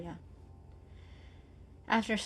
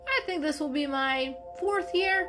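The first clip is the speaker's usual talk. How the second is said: much higher, louder breezy cat; golden cat voice; 6 semitones higher, 4 dB louder than usual. Acted. Golden cat explaining to Mocha, cheerful. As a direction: answer brightly.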